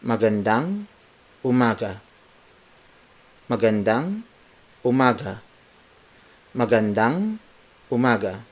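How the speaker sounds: careful word-by-word model, "Magandang" rising, then "umaga". Shown as a demonstration. Teacher breaking the morning greeting down for learners, word for word. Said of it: "Again, word for word".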